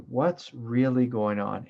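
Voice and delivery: slow, deliberate What's really going on; slow inner question. Shown as a demonstration. Teacher models slow self-inquiry for students. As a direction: ask it slowly, inwardly. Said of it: "You just say".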